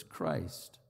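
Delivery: ordinary talk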